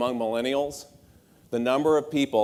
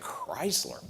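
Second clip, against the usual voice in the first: dismissive mock sigh; 7 dB quieter than usual. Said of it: "Everyone's like".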